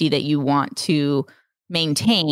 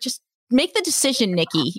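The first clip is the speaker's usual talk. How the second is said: higher, pushy self-nudge; impatient inner voice; 6 semitones higher than usual. Pretend. Describing inner dialogue, a higher impatient nudge. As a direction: hurry yourself up, mock impatient. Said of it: "internal conversation".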